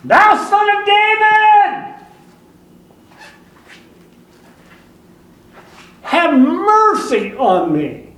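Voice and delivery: high, loud, slow pleading cry for mercy; desperate cry out. Retold or imitated. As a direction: call out to Jesus with everything you have. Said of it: "he started to cry out".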